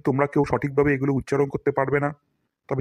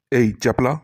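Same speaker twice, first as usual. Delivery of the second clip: ordinary delivery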